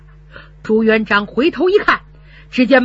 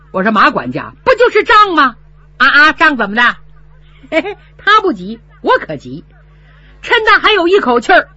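angry challenge; 4 semitones higher, 4 dB louder than usual. Acted. Son confronts steward.

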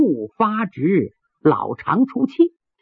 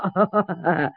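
fawning chuckle; 3 semitones lower, 5 dB quieter than usual. Acted. Fawning tea seller greets guest.

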